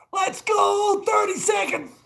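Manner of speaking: high, loud straining hype; holding a plank. Real, not acted. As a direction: shout through the burn of a plank. Last seconds of plank.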